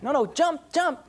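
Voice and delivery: high, insistent coaxing; urging parent. Retold or imitated. Parent urging a hesitant child to jump, high coaxing. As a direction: push the kid to jump, pitched high.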